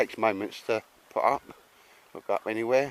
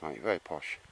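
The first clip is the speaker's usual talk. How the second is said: quiet lowered aside, still teasing; muttered tease; 6 semitones lower, 9 dB quieter than usual. Retold or imitated. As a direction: lower and softer, half to himself. Repeats tease, dropping away.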